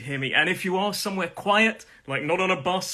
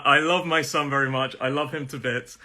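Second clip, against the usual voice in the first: usual speaking voice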